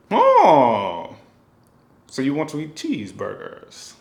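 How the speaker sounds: mock dubbed taunt. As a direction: sneer it like a cheesy kung fu dub. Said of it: "be like".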